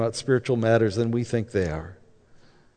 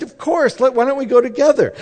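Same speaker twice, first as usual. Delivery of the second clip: high, brisk enthusiastic reply; quick and high, keen to go; eager agreement; 10 semitones higher, 3 dB louder than usual. Retold or imitated. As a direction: imitate a wife happily jumping on board. Retold wife eagerly agreeing.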